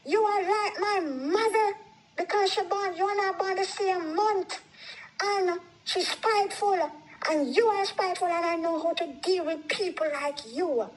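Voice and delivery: heated, high-pitched accusing quote; retold angry accusation. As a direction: imitate someone laying blame in a quarrel. Husband's accusation acted in retold quarrel.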